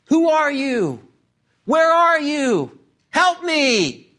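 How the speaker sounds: high, drawn-out calls up the cliff; acted cry for help; calling out. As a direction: plead loudly with someone out of sight. Acting Jack stranded on the cliff.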